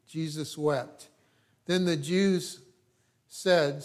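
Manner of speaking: ordinary talk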